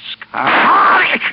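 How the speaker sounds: very high, loud terrified scream of a name; final terrified shout; desperate scream. Acted. Begging before being shot.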